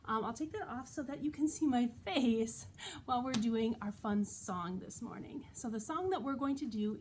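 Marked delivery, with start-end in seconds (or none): none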